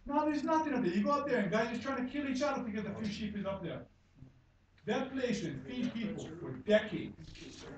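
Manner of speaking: usual speaking voice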